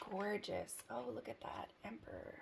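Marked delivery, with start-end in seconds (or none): 1.8-2.4 breathy whispered card name. Real, not acted. Reader revealing the card to viewers in a whisper. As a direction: hush it like a secret.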